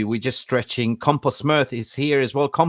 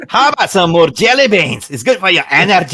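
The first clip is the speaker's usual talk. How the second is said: loud, high-pitched mock-Scottish holler; bad Scottish accent; 7 semitones higher, 9 dB louder than usual. Shown as a demonstration. Host trying again for his friends, hammed-up Scottish accent. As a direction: ham up a dreadful Scottish accent, half laughing. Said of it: "you do the Scottish accent".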